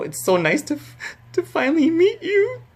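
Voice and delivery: imitated tearful voice climbing into a squeak; acting choked up, pitch shooting up; voice breaking. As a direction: start high and crack upward mid-sentence. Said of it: "my voice started breaking".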